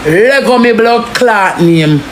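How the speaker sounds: ordinary talk, nothing marked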